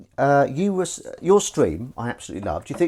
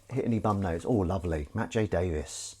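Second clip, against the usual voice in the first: ordinary talk, nothing marked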